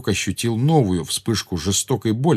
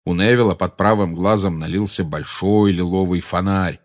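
ordinary delivery

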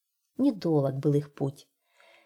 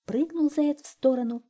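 usual speaking voice

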